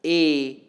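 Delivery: "a" as a long, full vowel; strong vowel. Shown as a demonstration. Lecturer showing students another strong vowel, "a" held long. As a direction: sound out "a" alone, sustained. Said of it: "These vowels are stronger than".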